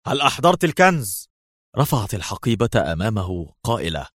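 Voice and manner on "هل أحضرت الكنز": higher, faked agitated voice; Levy's feigned nervousness. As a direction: put on another man's edgy voice. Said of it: "أجابها بصوت ليفي وهو يتصنع العصبية".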